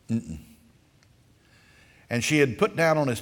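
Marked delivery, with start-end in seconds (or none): 0.1-0.4 stubborn refusal hum. Retold or imitated. Boy refuses to eat.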